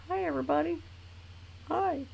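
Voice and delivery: high soft pet voice greeting; voicing the dog.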